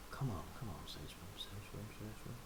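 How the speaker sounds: soft chant sinking to a whisper; fading whispered plea. Real, not acted. Pleading with Sean for a shrimp tank, fading to a whisper. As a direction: beg under your breath, fading away.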